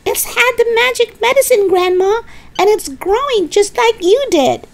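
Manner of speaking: put-on very high boyish holler; excited shouting. George explains the growing hen to Grandma, excited shout. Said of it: "pointing at the enormous hen and shouting".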